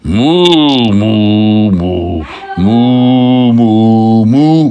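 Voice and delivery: emphatic string of cow calls; cow mooing back. Acted. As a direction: moo defiantly in reply. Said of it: "he replied in his own way".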